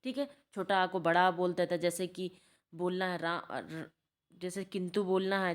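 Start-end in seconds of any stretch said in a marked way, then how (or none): none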